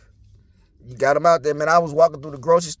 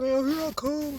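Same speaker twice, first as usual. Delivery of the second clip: higher, softer meek reply; imitated mumble; 7 semitones higher, 7 dB quieter than usual. Scolded man answering his girlfriend, imitated meek mumble.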